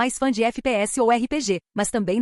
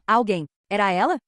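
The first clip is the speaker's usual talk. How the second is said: ordinary delivery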